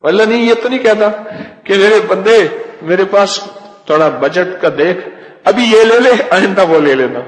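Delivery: high, loud, fast denial; fervent preaching. Acted. Preacher insisting to the congregation God never haggles, loud. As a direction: deny it to the crowd with passion.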